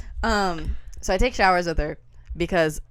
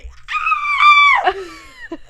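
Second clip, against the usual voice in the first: loud, very high-pitched shriek; high falling scream; 8 dB louder than usual. Retold or imitated. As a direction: let out a full re-enacted scream. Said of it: "and I scream".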